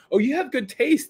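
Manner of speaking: high, teasing girlfriend impression; mocking new girlfriend. Imagined girlfriend ribbing him about his friends, mocking. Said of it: "you were making fun of, like, his new girlfriend".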